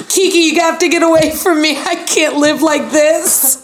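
real laughter breaking through high; giggling at the cat; laughing. Real, not acted. Cat interrupts, laughing fit.

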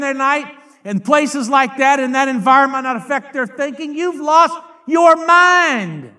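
high, forceful rebuke from the pulpit; full-force preaching. Real, not acted.

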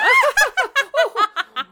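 put-on high shrieking cackle like an old hag; witch cackle. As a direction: cackle like a witch caught in the rain. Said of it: "That was a good witch cackle".

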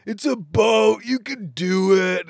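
high, loud jeering heckle; heckling. Retold or imitated. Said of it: "all this heckling".

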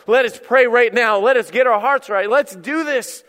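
loud, punched-out exhortation; rally the crew to prayer, phrase by phrase; acted preacher's call to action. Imagined rallying call to sailors.

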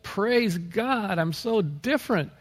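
high, drawn-out gushing praise; elated new convert. Retold or imitated. Imitating the newly saved for months afterwards, elated. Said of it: "we're just like".

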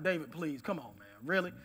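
low, soft, offhand doubt; mild dismissive disbelief. Retold or imitated. Brothers doubting David will fight, mild dismissive disbelief. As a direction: wave him off quietly, pitched low.

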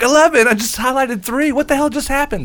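high, incredulous mock outburst; shocked disbelief. Retelling his shock at eleven files removed, incredulous. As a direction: act out alarm, voice jumping way up.

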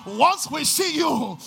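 loud, fervent preacher's cry; shouting the sermon's climax; full-force preaching. Acted.

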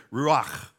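"ruach" ending in a guttural ch; Hebrew pronunciation. Shown as a demonstration. Pastor shows how Hebrew says it, "ruach" with throaty final ch. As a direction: scrape the throat on the end of "ruach". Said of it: "That's the way they say it".

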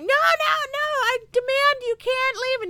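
high, desperate pleading cry; John pleading. Retold or imitated. Boy begs his protector not to leave, high pleading. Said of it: "John Connor's like".

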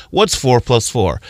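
slow, dazed What's four plus four? in an altered voice; a mildly dazed voice for What's four plus four?; lost kid wondering. Retold or imitated. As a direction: puzzle over What's four plus four? like a kid who never learned. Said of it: "keep walking around this earth thinking".

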